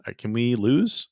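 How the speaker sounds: ordinary delivery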